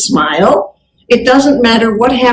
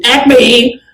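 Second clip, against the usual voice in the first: curt company-name answer; 2 semitones higher than usual. Shown as a demonstration. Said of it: "Some just go".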